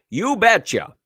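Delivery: high, punchy Minnesota "you betcha"; Minnesota accent. Pretend. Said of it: "In my best Minnesota accent".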